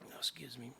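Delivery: ordinary talk, nothing marked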